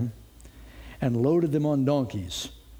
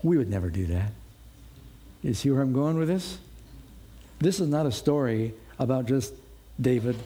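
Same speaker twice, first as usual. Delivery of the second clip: ordinary talk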